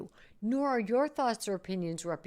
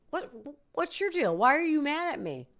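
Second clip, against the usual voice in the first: much higher and louder, accusing; mock angry confronting; 9 semitones higher, 9 dB louder than usual. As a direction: snap at the friend who looked angry. Acted confrontation with friend.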